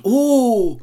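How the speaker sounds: high, loud amazed oh; crowd's amazed oh. A narrator's reading. The crowd watches Till on the roof, amazed oh. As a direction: sing out an amazed oh. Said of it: "Die Menschen ... riefen".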